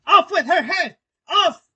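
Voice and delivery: very loud, high screamed command; furious scream. Acted. Queen crimson with fury ordering an execution, screaming.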